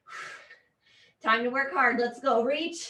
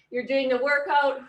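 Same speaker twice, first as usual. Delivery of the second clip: ordinary talk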